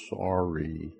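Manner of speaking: low, very soft little apology; apologetic child. Acted. Preacher voices a child hugging the one he kicked, softly sorry. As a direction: say it small and hushed, drawn out. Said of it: "gives you a hug and says".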